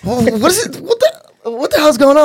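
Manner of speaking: high-pitched flustered sputter; getting all crazy. Retold or imitated. Fan stunned by meeting hockey player, freaking out. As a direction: act out a starstruck freak-out. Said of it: "I started getting all crazy".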